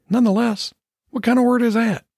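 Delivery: very high, scoffing dog voice; incredulous dog. Acted. Maggie puzzled by the cat's word, high and incredulous. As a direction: question the fancy word, high-pitched. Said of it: "Maggie and the cat exchanged telepathic remarks".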